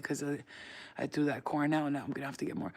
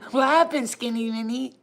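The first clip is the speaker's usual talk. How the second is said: high, loud doting question to a dog; pet talk; 7 semitones higher, 10 dB louder than usual. Real, not acted. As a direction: call out to your dog in a high voice. Calling to her dog.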